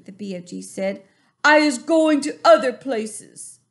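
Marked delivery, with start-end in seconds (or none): 1.4-3.6 higher, louder, politely firm giant; calm giant, higher pitch. A narrator's reading. BFG asking the bully to let go, politely firm.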